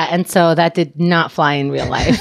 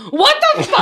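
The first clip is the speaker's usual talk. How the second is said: much higher, laughing disbelief; hammed-up shock, pitched way up; shocked reaction. Imitating boyfriend Keith's shocked reply, pitched up. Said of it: "He's like".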